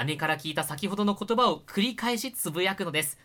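normal talking voice

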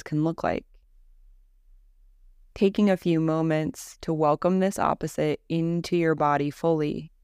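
calm, even Yoga Nidra guidance with long pauses; guided meditation. Acted. Teacher guiding listeners through Yoga Nidra practice, calm guided-meditation voice. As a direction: lead them gently into rest, leaving space after each phrase.

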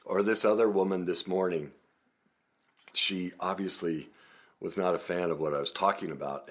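normal talking voice

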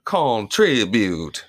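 slow, exaggerated bad British accent; botched British accent, syllables stretched out; bad British imitation.

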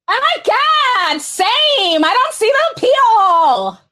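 put-on high, drawn-out valley girl gush. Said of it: "I got my Valley Girl voice on".

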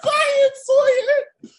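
high, loud, slow laughing cry; laughing shout. Real, not acted. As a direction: shout the name through laughter. Host cracks up at nickname.

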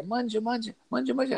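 put-on sing-song the nonna calling the kids to eat; a light imitation of a grandma offstage; Italian grandma voice. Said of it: "that sounds like my grandma".

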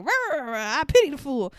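hammed-up bellow stretching into a high wail; loud weird-accented imitation. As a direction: parody shouty fighters' gibberish. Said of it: "loud talking and weird accents".

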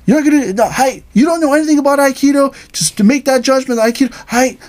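high, fast mock scolding teacher; hammed-up angry sensei; yelling sensei. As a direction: berate the student. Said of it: "Sensei yelled at me".

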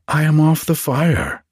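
ordinary delivery

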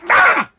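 high frustrated yell; outraged scream. Real, not acted. At the peak of his rant about dog food, a frustrated scream. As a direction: scream it out in disgust.

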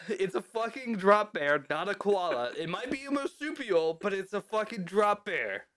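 high-pitched, indignant rant; exasperated outburst. Real, not acted. Host insisting it is no koala, comically exasperated.

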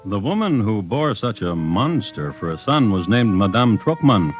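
ordinary talk

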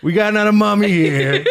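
high, loud foreman shout; foreman calling out. Pretend. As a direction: call it out like a weary foreman.

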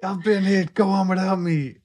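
hammed-up dying hero, high; overacted death scene. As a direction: play the melodramatic fallen soldier. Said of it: "this is the most dramatic it got".

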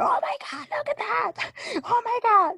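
tiny, very high-pitched mock squeal; giggly scandalized squeal. Three girls watching the forbidden channel, squealing. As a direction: squeak it like girls caught up in shock. Voices girls gawking at the screen.

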